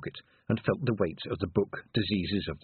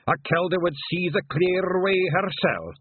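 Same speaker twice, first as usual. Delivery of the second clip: high, loud Scottish brogue; proud Scottish elder; 8 semitones higher, 4 dB louder than usual. Acted. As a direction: refuse her as a proud old Scot.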